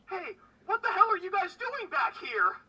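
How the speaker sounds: high, loud indignant yell; angry elf cook shout. Acted. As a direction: bark at intruders in your kitchen. Second sea elf confronts them.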